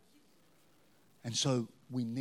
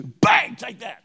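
loud playful bang and taunt; mock shout; 7 semitones higher, 13 dB louder, much slower than usual. Preacher jokingly sprays his mockers, shouting. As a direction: fire it off like a pretend spray.

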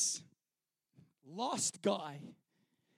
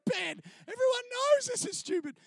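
pitched-up mock exasperation; high mocking scorn; 14 semitones higher, 3 dB louder than usual. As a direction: sneer loudly at the skit. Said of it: "And then I'm going".

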